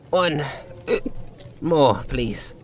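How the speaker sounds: put-on slurring drunkenly, pitch wandering up; drunken slur. Drunk Douglas asking the bartender for another, slurred. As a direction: let the words smear like too many drinks. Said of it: "Douglas slurred".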